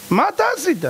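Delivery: high, pressing question; rising accusing demand; demanding high call. Shown as a demonstration. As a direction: demand "מה אתה עשית?" up high with stress on "אתה". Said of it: "repeated question raised".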